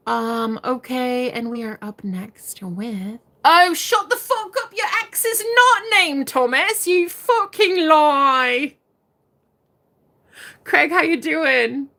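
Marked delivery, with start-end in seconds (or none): 3.4-8.8 high, loud playful outrage; squealing fake indignation; mock outrage. Real, not acted. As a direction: call the viewer a liar, half joking. Mock outrage at viewer comment.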